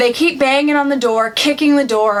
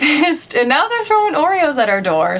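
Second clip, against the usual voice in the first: ordinary delivery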